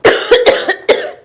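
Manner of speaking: loud, forced demo cough; hammed-up sample cough; demonstrated cough. Shown as a demonstration. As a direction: cough on purpose to show it. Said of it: "The next one that we have to look at is".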